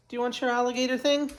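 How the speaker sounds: high quick pet voice. Real, not acted. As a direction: ask the dog brightly. Talking to the dog.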